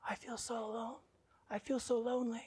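put-on tiny, high, very soft child voice; small hushed boyish lament; little boy. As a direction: imitate a lonely little boy. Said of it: "began talking like a little boy".

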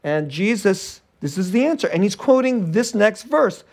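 ordinary delivery

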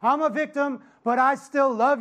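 high, loud whiny mock victim. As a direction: ham up a self-pitying victim. Mocking complaining churchgoer.

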